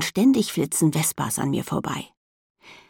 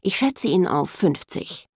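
ordinary delivery

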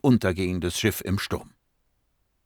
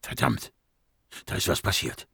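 low, hushed alarmed curse; tense, soft low voice; blurted out; 6 semitones lower, 5 dB quieter than usual. Acted. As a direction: blurt it under your breath. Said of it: "stieß Tebor hervor".

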